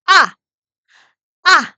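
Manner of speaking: loud 아 dropping sharply from the belly; falling fourth tone. Shown as a demonstration. Teacher demonstrating Mandarin fourth tone, forceful falling 아. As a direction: punch 아 down hard from the diaphragm. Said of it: "이렇게 배 힘으로".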